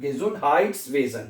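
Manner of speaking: "Gesundheitswesen" slowly, with a long "haaits"; teacher modelling "Gesundheitswesen" as ge-zund-haaits-ve-zen. Shown as a demonstration. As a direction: stretch the ei of "Gesundheitswesen" into a clear "ai". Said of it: "इसलिए इसको हम आई पढ़ेंगे".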